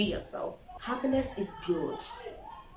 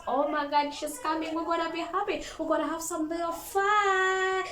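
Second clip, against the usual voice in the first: excited friends; 9 semitones higher than usual. Pretend. Friends greet the happy girl.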